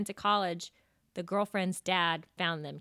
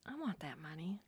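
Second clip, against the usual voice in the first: greedy whisper; 3 semitones lower, 13 dB quieter than usual. Real, not acted. Greedy aside after prices.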